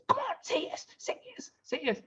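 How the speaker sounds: high, squeezed half-whisper; urgent hushed prodding. Retold or imitated. Wife nagging husband while he is on the phone, urgent whisper. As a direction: hiss it urgently under your breath, very high. Wife prods him during call.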